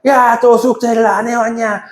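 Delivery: high, slow exasperated teacher nagging; scolding teacher. Retold or imitated. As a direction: nag the kid like a fed-up tutor. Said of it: "이게 아니야".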